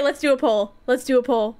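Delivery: usual speaking voice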